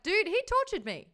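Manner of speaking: high, loud mock protest; imitating Nikolai. Nikolai protests to Yuri, high and loud. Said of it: "Nikolai's like".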